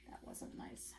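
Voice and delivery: hushed whisper, gently scolding; whispered disapproval. Real, not acted. Teacher whispering to the class that the animals were mean. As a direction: whisper it like you're sharing a frown.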